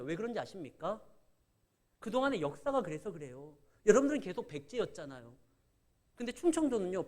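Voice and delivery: ordinary talk, nothing marked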